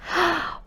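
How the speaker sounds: sharp excited gasp; a delighted little gasp. Acted. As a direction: gasp of wonder. Child gasps at news of Paris.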